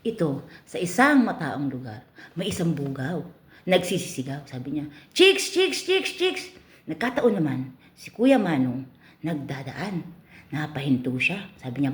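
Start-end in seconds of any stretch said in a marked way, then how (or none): 5.2-6.4 pimp calling out. Acted. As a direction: call it out to the crowd like a street barker.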